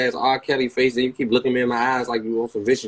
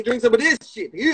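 lightly voiced God, higher; 9 semitones higher than usual. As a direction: hand over the drink as a mock deity. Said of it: "God say".

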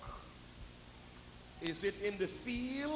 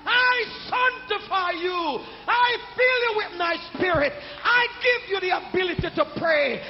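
louder, higher preaching with rhythmic shouted lines; full-force preaching; 8 semitones higher, 12 dB louder than usual. Acted. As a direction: hammer each line out to the congregation.

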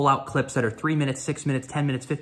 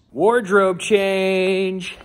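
very slow higher announcer call; drawn-out announcement; 7 semitones higher, 4 dB louder, much slower than usual. Real, not acted. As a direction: stretch it like an MC. Announcing outfit.

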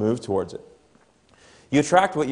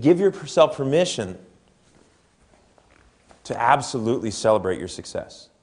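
ordinary talk, nothing marked

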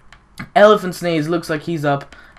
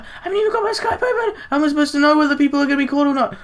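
high, fast flustered rush; 12 semitones higher, much faster than usual. Real, not acted. Gamer realising Skype is closed, high flustered rush. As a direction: gabble it in a panic.

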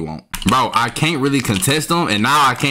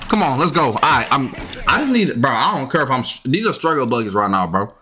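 usual speaking voice

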